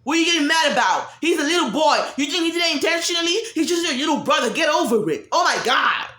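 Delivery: high, loud impatient shout; mom yelling. Retold or imitated. Mother shouting at her hurt child, loud and high. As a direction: snap at the child to stop crying. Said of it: "your mom is like".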